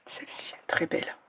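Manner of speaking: soft admiring whisper. Real, not acted. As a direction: breathe it out in a whisper. Admiring whisper over a ring.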